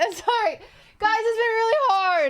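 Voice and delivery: real tears she can't hold back; breaking into tears. Real, not acted. Streamer tearing up while apologising to her chat, voice breaking. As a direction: voice cracking high as she starts to cry. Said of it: "She's literally gonna cry again".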